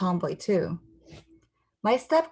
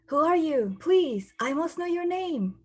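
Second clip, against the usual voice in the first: movie hero; 9 semitones higher than usual. Acted. Movie admirer asks mystery girl.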